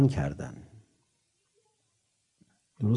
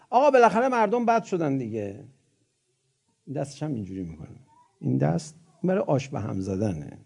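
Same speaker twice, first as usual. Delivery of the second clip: ordinary talk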